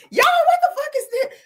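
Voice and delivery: very high, loud squeal through laughter; laughing disbelief. Real, not acted. Host asking viewers about the video, high laughing squeal.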